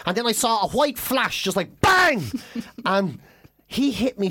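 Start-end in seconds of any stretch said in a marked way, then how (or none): 1.8-2.2 sudden loud high "bang"; exclaimed bang. Retold or imitated. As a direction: burst out with the punch sound. Punch flash imitated in a story.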